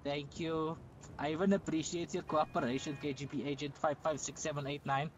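thick mock Russian accent. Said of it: "I can't say meth in a Russian accent".

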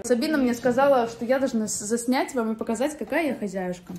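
ordinary talk

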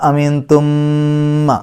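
very slow nasal-held chant of the phrase; Quran recitation. Shown as a demonstration. Teacher demonstrates the merged mim, chanting slowly. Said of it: "മണിച്ചിട്ട് പറഞ്ഞാൽ മതി".

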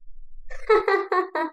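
high, taunting villain's laugh; mocking laugh. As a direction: laugh tauntingly in her ear. Mocking laugh after taunting her. (ضحكة ساخرة)

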